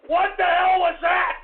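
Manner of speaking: high, loud, drawn-out shouting; appalled outrage. Real, not acted. Host appalled by a prank clip, outraged shouting. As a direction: explode in disgusted fury.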